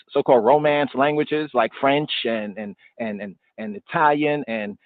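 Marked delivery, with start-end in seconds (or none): none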